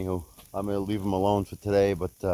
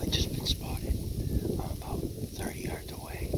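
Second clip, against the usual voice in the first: wildlife watching whisper; 5 dB quieter than usual. Real, not acted. Hunter stalking deer, whispering.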